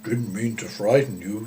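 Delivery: lightly voiced apologetic cow; a hint of a gentle cow apologizing. A narrator's reading. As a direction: cow soothing a startled girl, lightly voiced. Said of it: "said the cow".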